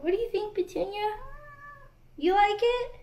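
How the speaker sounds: high, doting sing-song question; pet-talk voice. Real, not acted. Vlogger asking Petunia if she likes it, high pet-talk voice. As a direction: coo a question up at a beloved pet.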